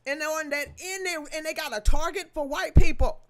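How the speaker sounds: loud furious climax; loud outrage at the biased judge; angry. Real, not acted. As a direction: hit it hard with outrage. Angry rant peak.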